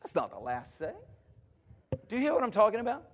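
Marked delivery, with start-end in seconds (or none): 0.0-0.9 very low, soft correction; hushed, low-pitched denial; quiet rebuttal. Real, not acted. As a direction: drop right down to contradict it. Preacher rebuts the listeners' thought.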